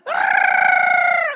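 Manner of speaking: very high, loud, long yell; deacon's shout. Retold or imitated. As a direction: imitate the deacon screaming out. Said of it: "he starts to shout".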